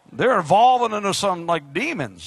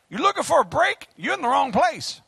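ordinary talk

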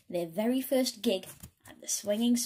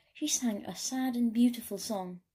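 ordinary delivery, nothing marked

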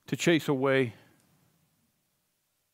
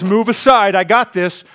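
bold shout; 3 semitones higher, 7 dB louder than usual. Acted. Shepherd voiced in sermon.

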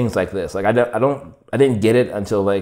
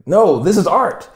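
high, loud and slow: no, this is art; drawn-out emphatic insistence; insistent buddy; 10 semitones higher, 6 dB louder, slower than usual. Retold or imitated. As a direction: lean on it like you're convincing him.

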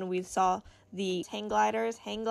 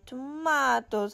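put-on playful accent, tomatoes as "to-MAH-toes"; mock-fancy accent on tomatoes, "tuh-MAH-toes"; 3 semitones higher, 5 dB louder, slower than usual. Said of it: "why I'm talking in an accent".

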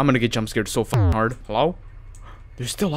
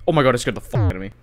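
ordinary talk

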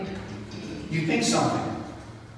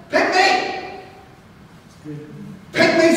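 put-on eager child; 9 semitones higher than usual. Little boy volunteers.

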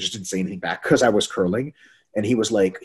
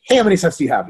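pouncing, louder and higher; too eager; 8 semitones higher, 6 dB louder, faster than usual. Acted. Pouncing on a lifter right after the set, too eager. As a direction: jump in on them with no breathing room. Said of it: "right after the set".

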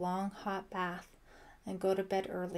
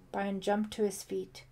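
plain reading voice

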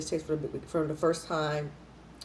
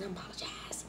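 whispered apology; 16 dB quieter than usual. Real, not acted. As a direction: whisper it, embarrassed. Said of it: "I think I'm spitting".

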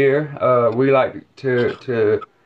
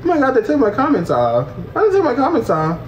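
high-pitched mock dismay; mocking imitation; 9 semitones higher than usual. Mocking her for losing comments, high-pitched imitation. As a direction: mimic her complaint in a high voice. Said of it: "Not her taking off the comments and then saying".

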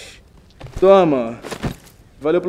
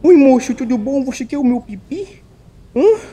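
high silly stranger voice; 6 semitones higher than usual. Acted. Voicing a weird stranger.

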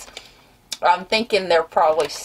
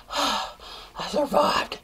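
soft high sigh of relief; relieved sigh; 5 semitones higher, 8 dB quieter, slower than usual. Real, not acted. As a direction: breathe out, spent. Relief after sour candy.

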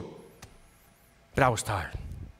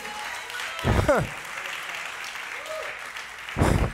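high unvoiced laugh; 14 semitones higher, 6 dB quieter than usual. Real, not acted. After joyful remark.

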